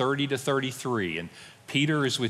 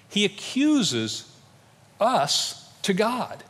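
"He accuses us to God" drawn out slowly, higher, each word weighted; slow preacher's emphasis, pitched higher; slow emphatic stress; 7 semitones higher, much slower than usual. Real, not acted. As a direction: lean hard on "accuses" and "God", stretching them slowly. Preacher stresses the key point.

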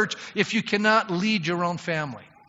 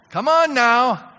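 loud, slow, drawn-out rally cry; rousing call; 6 semitones higher, 8 dB louder, slower than usual. Real, not acted. Preacher rallying his church, loud and slow. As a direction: stir up the congregation.

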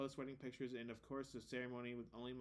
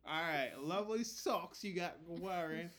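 mock London street accent; 4 semitones higher, 5 dB louder, slower than usual. Hosts put on the researched accent.

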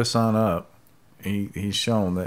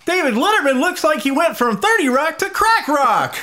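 shrieking, high and loud with excitement; bursting with over-the-top excitement; excited; 7 dB louder than usual. Shown as a demonstration. Reading a party-game card in an excited voice. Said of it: "the accent was supposed to be excited".

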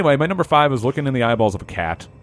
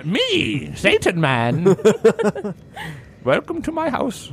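put-on old prospector voice; 5 semitones higher than usual. Playing Satan man character.

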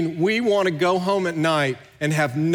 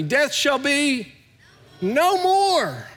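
proclaimed together; 9 semitones higher, much slower than usual. Shown as a demonstration. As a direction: lead the congregation in saying it aloud. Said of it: "Say that with me".

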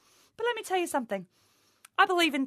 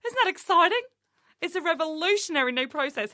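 fake excitement; 6 semitones higher than usual. Fake enthusiasm.

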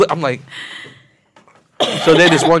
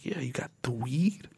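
voice dropped down; 2 semitones lower, 16 dB quieter than usual. Retold or imitated. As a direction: whisper it like a secret deal. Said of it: "my voice goes down".

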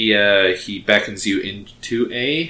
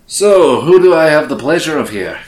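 loud, hammed-up Scottish dwarf; bad Scottish accent; 3 semitones higher, 9 dB louder than usual. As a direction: boom it out in a badly faked Scottish accent. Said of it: "in a very bad Scottish accent".

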